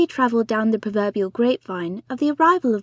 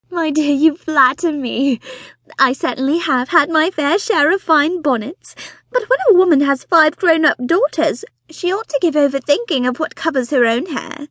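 high, loud, breathless society wife; fussy excited mother; 5 semitones higher, 4 dB louder than usual. Acted. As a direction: gush it out like an eager matchmaking mother. Mrs Bonnet flattered.

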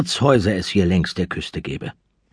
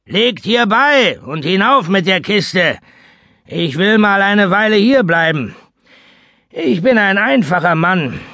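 rough, commanding seaman; 8 semitones higher, 3 dB louder than usual. Acted. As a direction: order them about like an old sea captain. Seaman gives orders, takes lodging.

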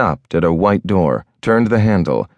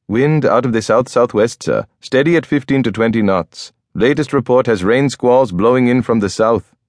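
higher, distinct officer voice, brisk report; Sikh officer reporting; 5 semitones higher than usual. A narrator's reading. Officer reporting the wind to the captain, higher and brisk. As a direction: read the weather off like a duty officer. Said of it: "the Sikh officer reported".

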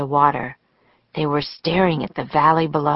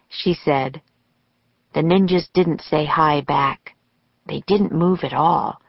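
usual speaking voice

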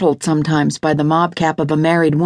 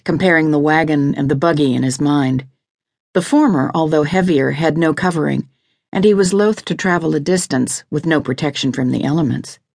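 usual speaking voice

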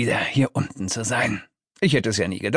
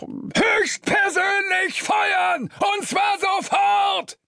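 very high, drawn-out shout; explode, stretching each word; screaming fury, pitched way up; enraged roar; much slower than usual. A narrator's reading. Boss explodes and fires demons.

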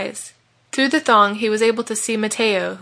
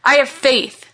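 raised, resolute declaration; determined cry; 5 semitones higher, 5 dB louder, slower than usual. A narrator's reading. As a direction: declare it as you charge. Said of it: "cried Malachi".

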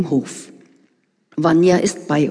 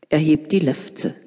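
ordinary delivery, nothing marked

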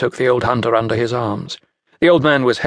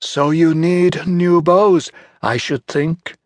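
Centaur scheming; 5 semitones higher, slower than usual. Acted. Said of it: "Centaur said".

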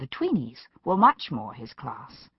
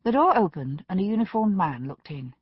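usual speaking voice

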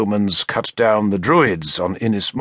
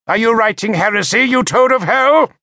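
much higher, louder, fast accusing shriek; shrill accusation; 11 semitones higher, 5 dB louder than usual. A narrator's reading. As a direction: spit it out in rising fury. Bishop accuses narrator of heresy.

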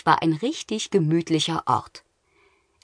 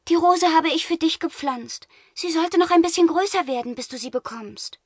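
put-on shy girl voice; 12 semitones higher than usual. Gerda explains gift.

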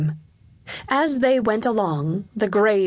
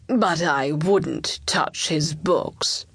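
slow, heavy warning; cautioning courtier; 3 semitones lower, much slower than usual. Acted. Chancellor warning the king off the books, slow and grave. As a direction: warn him off slowly and gravely.

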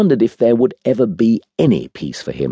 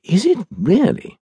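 very high, innocent surprised murmur; ingenuous surprise. A narrator's reading. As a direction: feign wide-eyed surprise in a high voice. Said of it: "the saint registered ingenuous surprise".

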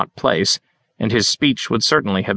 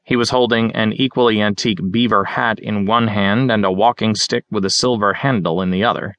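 ordinary delivery

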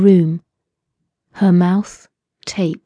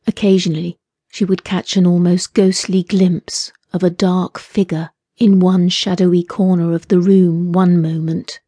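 ordinary delivery, nothing marked

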